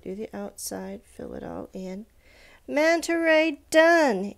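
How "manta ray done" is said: excited. Real, not acted. Triumphant finish of drawing.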